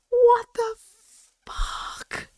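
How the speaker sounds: breathless whispered disbelief; shocked whisper. Real, not acted. Streamer stunned by the story's ending, shocked whisper. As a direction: gasp it out under the breath.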